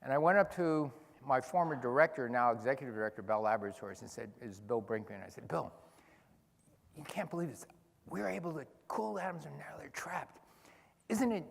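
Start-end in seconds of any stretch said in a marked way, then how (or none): 5.5-11.4 retold excitement. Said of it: "he knew I was excited".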